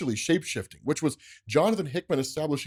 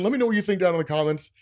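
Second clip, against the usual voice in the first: ordinary talk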